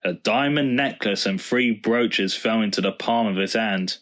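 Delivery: ordinary delivery, nothing marked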